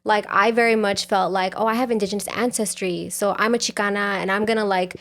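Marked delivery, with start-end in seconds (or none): none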